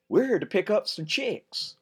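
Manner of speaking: put-on cheerful little boy; innocent boy. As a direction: blurt it like a clueless kid. Ben's embarrassing line.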